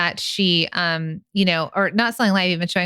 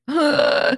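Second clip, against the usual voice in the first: shocked gasp; 9 semitones higher than usual. Pretend. Acting a nervous listener.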